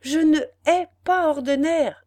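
high, loud bold protest; emboldened declaration. A narrator's reading. Ethel tells her father boldly, high and loud. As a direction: speak up bravely at last. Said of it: "ajouta Ethel enhardie".

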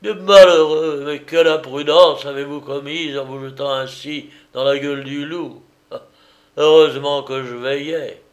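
ringing, loud scolding; trumpeting rebuke from the rescuer; clarion voice. A narrator's reading. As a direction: call out boldly, full voice. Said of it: "il lança de sa voix claironnante".

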